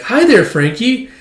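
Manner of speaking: high, drawn-out imitation of a woman; woman's greeting. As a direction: greet him sweetly in a put-on high voice. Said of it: "this woman's like".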